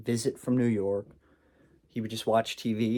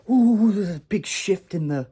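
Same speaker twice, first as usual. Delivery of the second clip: high, loud swooping ooh; awestruck outburst; 8 semitones higher, 7 dB louder than usual. Acted. Stepping outdoors while tripping, awestruck outburst. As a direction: marvel loudly, starting high and sliding down.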